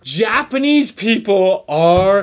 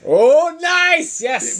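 delighted cheer; 8 semitones higher, much slower than usual. Real, not acted. Cheering the co-host's defense, delighted cheer.

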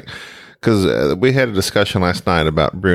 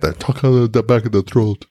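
mock Quebec French accent from the throat; French Canadian accent; 3 semitones higher than usual. Explaining how Quebecers talk, imitated French Canadian accent. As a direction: talk from the back of the throat, Québécois-style. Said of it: "whereas the French Canadians from Quebec".

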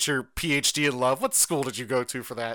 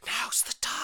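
whisper; 3 dB quieter than usual. Retold or imitated. Friend's secret inner cue.

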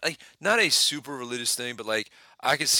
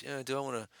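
half-asleep; 3 semitones lower, 11 dB quieter than usual. Retold or imitated. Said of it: "you're, like, halfway asleep".

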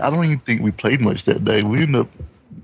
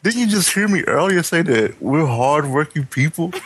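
mock indignation; 7 semitones higher than usual. Keeps up feigned innocence.

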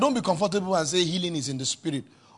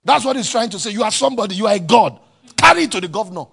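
loud, high preaching cry; forceful preaching; 5 semitones higher, 9 dB louder, faster than usual. Acted. Pastor preaching hard to his congregation, loud and high. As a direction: preach it out with fire.

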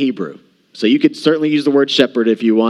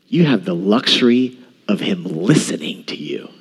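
whisper; 4 semitones lower than usual. Real, not acted. Intimate point in sermon.

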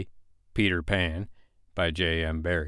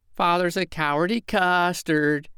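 put-on high sing-song child's taunt; 9 semitones higher than usual. A narrator's reading. Michael calling Father a coward, taunting. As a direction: jeer in a little boy's voice.

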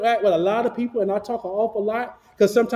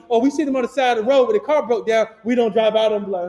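ordinary talk

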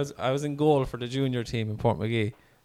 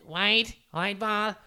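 referee's call; 9 semitones higher, much slower than usual. Retold or imitated. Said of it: "Referee goes".

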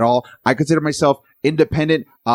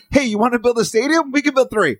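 higher, breezy showing-off; bragging, way up in pitch; mock offer; 8 semitones higher than usual. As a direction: play a smug official flaunting cash. Mock voicing flush state officials.

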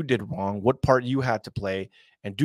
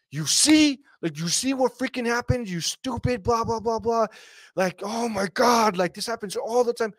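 much higher, hammed-up exasperated scolding; mock angry rant; 12 semitones higher than usual. Husband mocking how he'd rant at his wife, high-pitched mock fury. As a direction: play the hothead husband blowing up over nothing. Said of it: "Imagine I let that incident rile me up, and then I get angry".

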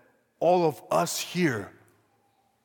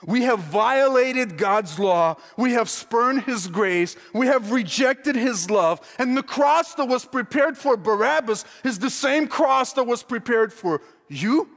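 emphatic preaching; 8 semitones higher, 4 dB louder, slower than usual. Acted.

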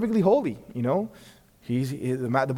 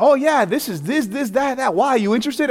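higher, keen fisherman chatter; eager fishermen; 6 semitones higher than usual. Retold or imitated. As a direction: gush about the catch like a proud fisherman. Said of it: "they're passionate about fishes, so they're like".

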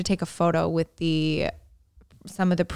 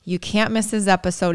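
ordinary talk

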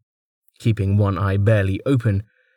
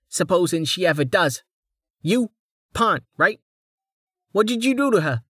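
thin reedy voice; 8 semitones higher than usual. Acted. Male noble voiced.

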